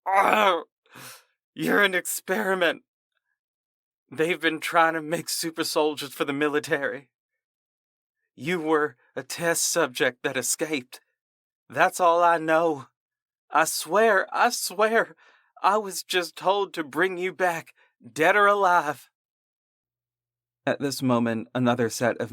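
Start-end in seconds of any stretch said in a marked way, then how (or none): 0.1-12.9 high, pained, panicked pleading; crying out in pain. Acted. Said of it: "He cried out in pain". 13.5-14.3 pleading. Acted. Terrified soldier begging. 14.3-15.2 even higher, more desperate begging; higher desperate plea. Acted. Terrified soldier begging. 15.6-19.1 high, pained, panicked pleading; crying out in pain. Acted. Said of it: "He cried out in pain".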